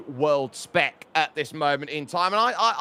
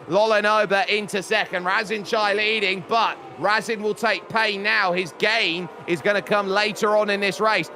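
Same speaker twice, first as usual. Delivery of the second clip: raised, excited play-by-play; live race commentary; 6 semitones higher than usual. Real, not acted. Commentator following the fight for points, excited live commentary. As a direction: call the midpack battle with high energy.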